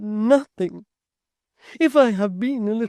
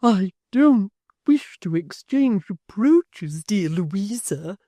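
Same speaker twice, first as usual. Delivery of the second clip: sobbing; 7 semitones higher, 10 dB louder, much slower than usual. A narrator's reading. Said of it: "sobbed Miss Tox".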